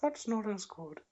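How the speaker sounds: mock him dropping out of character; Paul Bearer impression. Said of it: "That was Paul Bearer coming out of character".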